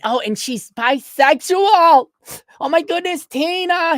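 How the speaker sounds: hammed-up popular girl gasping to her friend; mock valley girl. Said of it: "Do they talk like that in Canada?".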